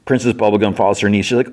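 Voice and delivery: ordinary talk, nothing marked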